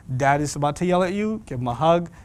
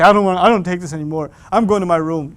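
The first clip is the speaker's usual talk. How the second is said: storming off; 7 dB louder than usual. Pretend. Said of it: "Don't say".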